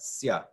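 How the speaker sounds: "sia" in one falling syllable, "sya"; blended vowels. Shown as a demonstration. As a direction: run I and A together in "sia". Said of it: "blend together to make one sound".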